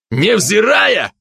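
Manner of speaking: high, drawn-out angry echo; exasperated, stretched-out outburst; flaring up angrily. A narrator's reading. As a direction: throw the word back in a temper. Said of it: "вспылил Капралов".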